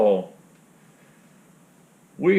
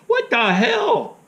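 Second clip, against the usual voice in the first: higher, slow, stretched-out indignant voice; mock outrage; 10 semitones higher, 4 dB louder, slower than usual. Friend hears the cotton line, slow, higher mock outrage. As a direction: drag out the shock like an old man. Jim voiced in retold call.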